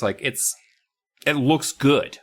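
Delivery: ordinary delivery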